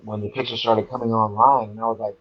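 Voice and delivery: normal talking voice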